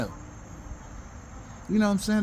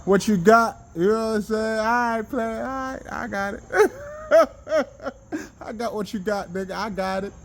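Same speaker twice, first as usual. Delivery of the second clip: laughing; 6 semitones higher than usual. Real, not acted. Laughing through teasing lines.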